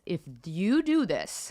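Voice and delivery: slow, deliberate warning, word by word; making it clear. Retold or imitated. Daughter warning both parents, deliberate and clear. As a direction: spell out the boundary firmly. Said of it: "I made it clear to both my parents".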